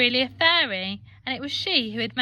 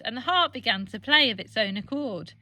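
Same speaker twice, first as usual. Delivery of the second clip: ordinary delivery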